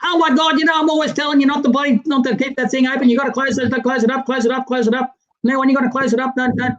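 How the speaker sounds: high-pitched, nagging mock wife scolding her husband. Wife told about the snake scolds him in a high nagging voice. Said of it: "And then when I did".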